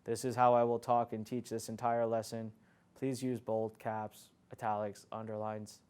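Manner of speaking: flat, low, soft monotone; monotone drone. Shown as a demonstration. Teacher showing students a lifeless lecture, low dull monotone. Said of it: "How would you like to listen to me right now?".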